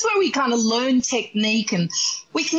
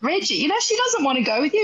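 normal talking voice

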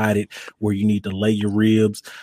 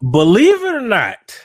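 booming ringmaster announcement, higher and louder; 8 semitones higher, 5 dB louder than usual. Acted. Mock announcement to podcast audience.

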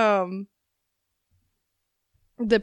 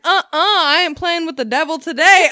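high, louder sassy refusal; mock coworker; 8 semitones higher, 7 dB louder than usual. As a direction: refuse with sass like a superstitious coworker. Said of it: "She's like".

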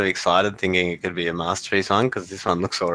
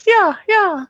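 much higher, squeaky cartoon yeah; squeaky mock voice; 6 dB louder than usual. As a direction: squeak it out like a toy. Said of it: "they're like".

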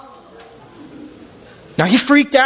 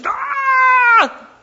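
shrill short mock shriek; a comic high scream. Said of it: "heard a death-curdling scream".

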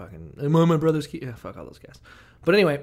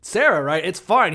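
higher, louder, hammed-up casual take; breezy higher voice like careless princes; mock princes; 7 semitones higher, 6 dB louder than usual. Princes voiced taking Sarah.

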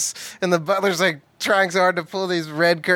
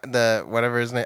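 ordinary delivery

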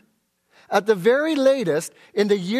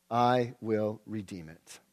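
low, slow redeemer's reply; 11 semitones lower, 10 dB quieter, slower than usual. Acted. Redeemer's reply quoted from scripture.

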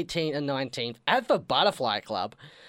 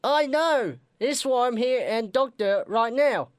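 put-on sick patient in a British accent; 6 semitones higher than usual. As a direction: croak it out in a bad British accent. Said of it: "something with British accents".

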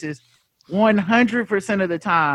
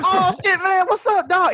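much higher, laughing excited hello; thrilled greeting; 12 semitones higher than usual. Retold or imitated. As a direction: squeal it like meeting your hero. Thrilled man greeting Seagal.

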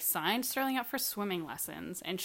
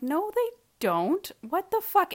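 higher, louder, slowed incredulous snap; exasperated retort; 11 semitones higher, 7 dB louder, slower than usual. Retold or imitated. Arguing with mom about swim class, exasperated. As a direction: push back hard in disbelief.

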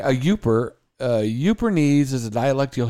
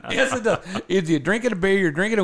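high insistent; 7 semitones higher, faster than usual. Retold or imitated. Insisting in a retold argument.

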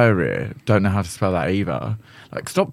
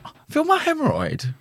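put-on much higher, innocent little child voice; childlike curiosity. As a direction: ask it like a curious toddler. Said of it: "a childlike curiosity where they're like".

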